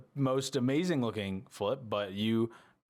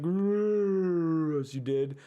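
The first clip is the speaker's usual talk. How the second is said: very slow, loud, higher creaking tree giant; Treant voice; 6 semitones higher, 5 dB louder, much slower than usual. Acted. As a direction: stretch it out like a huge waking tree. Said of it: "That was my Treant impression".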